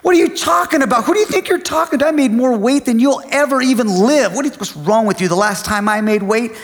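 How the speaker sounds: ranting dad, high-pitched and indignant; acted outraged boasting at his son; going off on someone. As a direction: launch into a hammed-up angry tirade, voice way up. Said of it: "I just started going off on him".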